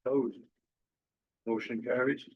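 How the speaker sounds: usual speaking voice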